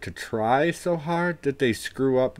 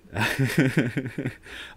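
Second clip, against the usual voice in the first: hearty laugh; 5 semitones higher than usual. Real, not acted. Laughs at chat insult.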